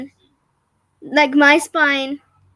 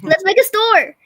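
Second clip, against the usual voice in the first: cheerful mom; 6 semitones higher, 7 dB louder than usual. Retold or imitated. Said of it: "And she's like".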